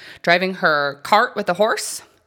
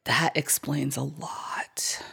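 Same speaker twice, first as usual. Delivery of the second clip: whispered aside; 2 semitones lower, 8 dB quieter, slower than usual. Real, not acted.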